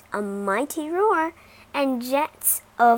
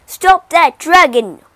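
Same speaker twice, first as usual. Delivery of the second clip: loud, high commanding yell; king yelling an urgent command; shouted order; 5 semitones higher, 15 dB louder than usual. Acted. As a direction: bark the order at the guards. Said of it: "ordered the king".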